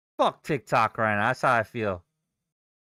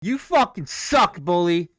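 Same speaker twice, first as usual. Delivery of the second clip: louder and higher, yelling at the screen; angry yell; 3 semitones higher, 4 dB louder than usual. Real, not acted. Trader yelling at the falling stock, angry loud jeer. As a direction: jeer at the chart like a bully's target.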